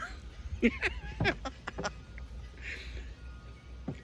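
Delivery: breathless high-pitched giggle; high wheezing laugh. Real, not acted. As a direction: laugh helplessly at the joke. Laughing at own story.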